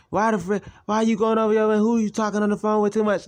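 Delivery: mock angry mother. Mother yells at son over phone minutes. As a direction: scold him in her high voice. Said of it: "she was cussing me out".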